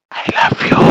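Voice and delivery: breathy whispered I love you; husky whisper. Pretend. Husband whispering to his new bride, husky. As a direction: whisper it to woo her. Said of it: "அஸ்கி வாய்ஸ்ல பேசினா".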